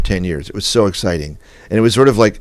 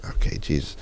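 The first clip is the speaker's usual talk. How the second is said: exasperated mutter; 5 semitones lower, 9 dB quieter than usual. Retold or imitated. Exasperated reply to the woman.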